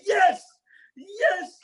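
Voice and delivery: high, loud excited yelp; excited yes. Retold or imitated. Retelling the halftime scene, high excited yelp. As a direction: leap up yelling yes. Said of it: "He stood up and said".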